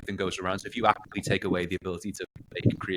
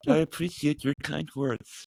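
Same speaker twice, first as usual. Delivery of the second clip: slow, higher robot voice; measured, mechanical thank-you; 7 semitones higher, slower than usual. Pretend. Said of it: "do the robot voice".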